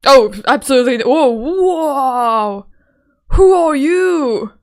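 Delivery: loud, booming character voice; wrong character voice. Acted. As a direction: draw out the question in a big, loud voice. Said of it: "absolutely not the voice that I was supposed to do".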